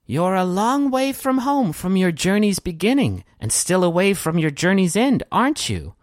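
high, lilting otherworldly judge; first disembodied voice. Acted. Game master voices a justice from the heavens, high and otherworldly. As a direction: pitch it high, drawing out a knowing question. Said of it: "I'm gonna try and do and try to keep separate".

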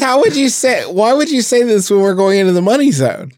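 high-pitched, incredulous protest; worked-up, high outburst; exasperated disbelief. Real, not acted. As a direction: scold a friend in amazed exasperation. Exasperated at news before ad break.

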